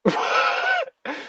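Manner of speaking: high squealing burst of laughter; shrieking laugh. Real, not acted. Reaction to the yell.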